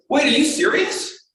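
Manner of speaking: very high, slow stunned question; incredulous double-check, acted out; disbelieving. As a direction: imitate a colleague who can't believe it. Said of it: "he just couldn't believe it".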